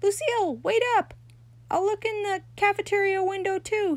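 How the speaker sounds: high, loud call after her; a boy shouting after a girl across the playground. A narrator's reading. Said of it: "he began to shout".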